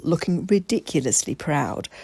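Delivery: plain reading voice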